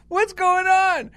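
high, slow and puzzled; a confused retold what's going on; mock bewildered, pitched high; baffled question.

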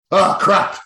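a hint of a cartoon clerk; oh crap with a touch of cartoon panic; lightly voiced cartoon clerk. Mocking the Simpsons clerk's oh crap. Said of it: "that guy, and he's like".

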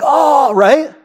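high, exultant cry; acted burst of exultation. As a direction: let out an overjoyed "oh". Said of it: "Exultation? You just be like".

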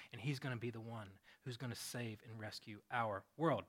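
soft, earnest, hushed explanation; gentle and low, with conviction; tender reassurance. Acted. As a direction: quietly share a wondrous promise. Matthew tenderly explains to scribe.

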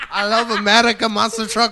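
hammed-up French accent as an eager American kid; a French kid bragging about America, in a put-on French accent. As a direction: play a French boy insisting he loves America. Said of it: "fun to be allowed to do an accent".

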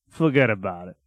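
low, imitated Chicago catchphrase; Chicago accent attempt. Shown as a demonstration. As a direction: drop the pitch and copy a Chicago accent. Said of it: "How do I get my Chicago accent?".

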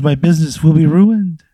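high, loud panicked outburst; acted alarm. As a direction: cry out as if ruin is looming. Acted despair over ruined business.

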